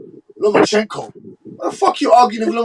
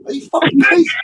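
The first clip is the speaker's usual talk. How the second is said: indignant rant; 11 semitones higher, 4 dB louder than usual. Acted. Ranting at boxer.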